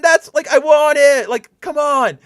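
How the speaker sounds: high, loud whining insistence, slowing; whiny demand. Retold or imitated. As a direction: whine for what you want, then give up. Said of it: "And I'm just like".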